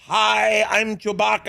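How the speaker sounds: loud, high shouted greeting in a hopelessly wrong creature voice; clueless Chewbacca impression. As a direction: imitate someone badly faking a movie creature, shouting. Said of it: "can you do an impression of Chewbacca".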